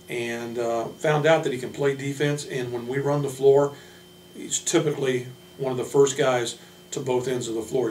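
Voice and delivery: ordinary talk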